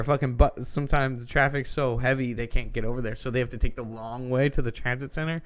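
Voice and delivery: plain reading voice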